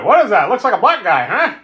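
high, loud mock Stephen King; hammed-up high-pitched truck driver; Stephen King impression. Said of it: "the way he says that".

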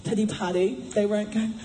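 ordinary delivery

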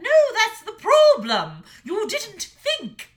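high, loud furious snarl. A narrator's reading. Mother dragon snarling at her son, high and loud. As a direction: bite every word at him. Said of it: "she snarled".

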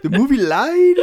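very high, loud cracking-up shriek; over-the-top squealed question; hammed-up disbelief. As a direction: wail it in mock outrage, half laughing. Hammed-up repeat of the movie line.

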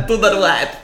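high hammed-up Russian accent; mock Russian accent. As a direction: do not wipe as a comic Russian. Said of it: "It sounded Russian".

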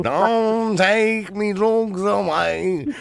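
very slow, high, wailing plea; whiny addict voice. Retold or imitated. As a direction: beg in a drawn-out, whining addict voice. Repeats addict caller impression.

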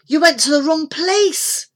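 put-on high, loud exasperated boy; urgent boy. A narrator's reading. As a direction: tell him insistently he got it wrong. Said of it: "said Mick".

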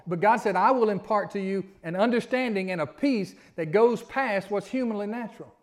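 loud, rising preacher's build; fervent preaching. Acted. Preaching builds to full force.

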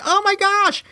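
sudden high, panicked cry of alarm; panicked gasp. Retold or imitated. Kid watching friend fall through ice, high panicked cry. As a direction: shriek it as the ice cracks under your friend. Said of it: "I'm freaking out".

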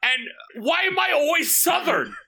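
laughing. Real, not acted. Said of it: "laughing protest at impressions".

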